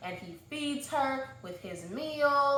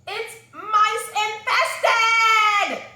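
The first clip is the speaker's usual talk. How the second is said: much higher and louder, slow, a drawn-out cry of disgust; horrified shout; 11 semitones higher, 10 dB louder, much slower than usual. Retold or imitated. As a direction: shriek it out in horror at the mice. Shocked at the mice upstairs.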